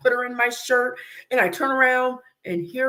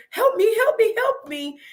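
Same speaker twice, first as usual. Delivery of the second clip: retold screaming; 12 semitones higher than usual. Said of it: "I'm screaming".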